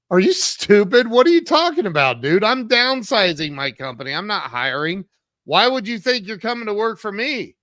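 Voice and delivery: loud, high, incredulous retort; indignant outburst. Retold or imitated. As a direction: fire it back loudly, as if insulted. Acting out his indignant reply in bar.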